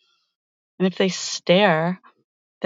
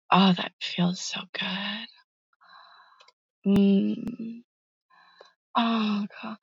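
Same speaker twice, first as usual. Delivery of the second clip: soft moaning; 7 dB quieter, slower than usual. Acted. Foot rub pleasure.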